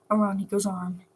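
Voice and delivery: plain reading voice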